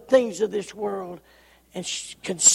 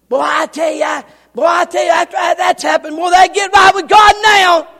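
higher, louder, fast, building shout; preaching at full force; 8 semitones higher, 13 dB louder, faster than usual. Real, not acted. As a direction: drive it home at full force.

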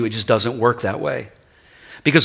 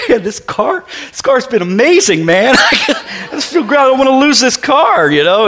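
tearful retelling; 10 semitones higher, 3 dB louder than usual. Retold or imitated. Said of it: "And I just start crying".

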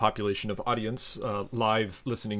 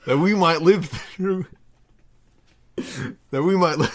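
giggling; 6 semitones higher, 6 dB louder, slower than usual. Real, not acted. Reading through giggles.

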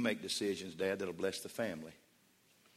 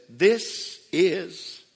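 slow, loud, booming heavenly voice; a louder, slowed voice from heaven; voice of God; 8 semitones higher, 7 dB louder, much slower than usual. Acted. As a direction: proclaim it slowly like God from heaven. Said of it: "there was a voice from heaven that said".

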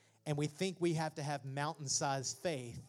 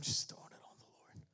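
whisper; 7 dB quieter than usual. Real, not acted.

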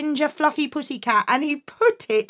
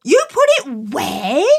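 disbelieving mum; 5 dB louder, slower than usual. Acted. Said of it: "said Mum".

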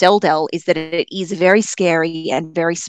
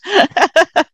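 high, louder laugh trailing off; real laugh; 12 semitones higher, 5 dB louder than usual. Real, not acted. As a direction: laugh it off, amused. Laughing after that's so mean.

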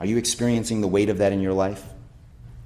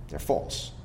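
1 whispered aside; 4 semitones lower, 9 dB quieter than usual. Real, not acted. Dismissing false gods, aside.

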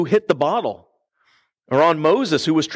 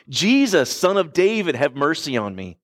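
normal talking voice

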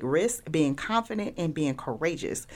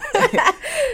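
loud high-pitched burst of laughter; 7 dB louder than usual. Real, not acted. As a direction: cackle at your own joke. Laughs at own story.

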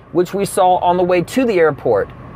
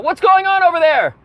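raised voice calling across cabin; yelling out; 10 semitones higher than usual. Retold or imitated. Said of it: "somebody yells out".

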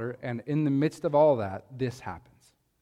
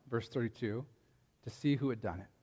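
normal talking voice

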